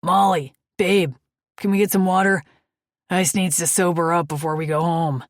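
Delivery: loud, friendly biker shout across the diner; calling out. Acted. Biker calling the waitress across the diner, loud and cheerful. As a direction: call out to the waitress, hearty. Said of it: "One guy calls out".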